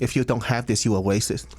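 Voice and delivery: put-on Cantonese-English accent; light Cantonese accent, played for laughs; Americanized Chinese accent. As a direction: imitate a Hong Kong Chinese man speaking English. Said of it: "It's like an Americanized Chinese accent".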